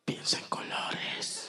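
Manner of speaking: holistic whisper. Retold or imitated. Masseuse whispering to the client during the massage, slow mystical whisper. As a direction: hush it like a new-age healer. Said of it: "le dice con voz holística".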